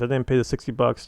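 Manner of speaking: usual speaking voice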